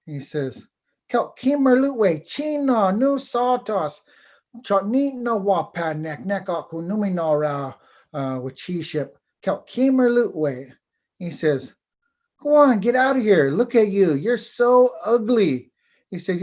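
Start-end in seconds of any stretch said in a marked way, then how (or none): 1.1-10.7 much higher, haughty tree voice; the proud dogwood brushing off the bird; high, scornful tree spirit; vain dogwood tree. Acted. 12.4-15.7 higher, louder, sneering dismissal; high, loud, disdainful tree voice; scornful dogwood tree. Acted. Dogwood tree sends bird away.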